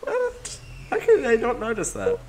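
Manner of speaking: high disbelieving question; surprised. Real, not acted. Surprise at friend's ignorance.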